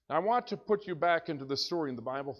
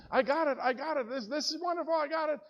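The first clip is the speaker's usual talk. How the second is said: higher, eager and delighted; excited; 10 semitones higher, faster than usual. Retold or imitated. Pastor replaying his thrill to friends, excited and high. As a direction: gush with hunter's joy. Retelling his joy at the kill.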